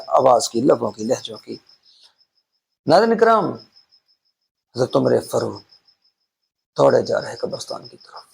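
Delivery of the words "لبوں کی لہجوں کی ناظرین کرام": usual speaking voice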